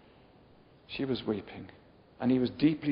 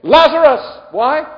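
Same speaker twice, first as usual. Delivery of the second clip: high, ringing shout; clear loud call; 12 semitones higher, 15 dB louder than usual. Shown as a demonstration. Preacher showing Jesus calling Lazarus, clear shout. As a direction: project it clearly across a cemetery. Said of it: "This was clear".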